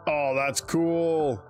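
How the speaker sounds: usual speaking voice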